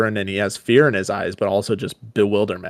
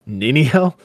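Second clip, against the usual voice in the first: acted incredulous question, pitched higher; raised, rising query in a dramatic reading; questioning echo; 5 semitones higher, 4 dB louder than usual. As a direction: throw the name back as a question. Mocking echo of the wife's name.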